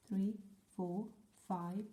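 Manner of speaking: ordinary delivery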